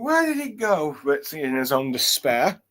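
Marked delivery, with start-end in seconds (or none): 0.0-1.0 worried searching. Retold or imitated.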